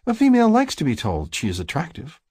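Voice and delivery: high, know-it-all sister voice; high-pitched sisterly lecture; lecturing sister. A narrator's reading. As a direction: lecture your brother like the expert. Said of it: "My sister lectures me as if she is the expert".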